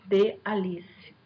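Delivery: "de Alice" said slowly, leaning on the stressed "li"; stress demo. Shown as a demonstration. Teacher slowly showing students the stress in "de Alice". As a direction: draw out "de Alice" to show where the stress falls. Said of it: "é a tonicidade desse conjunto".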